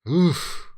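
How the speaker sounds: short high, loud "oof" of dismay; a wincing oof; pained oof. Real, not acted. Vlogger wincing at the production scandal, pained oof. Reacting to bad news.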